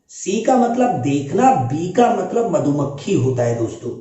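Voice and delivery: normal talking voice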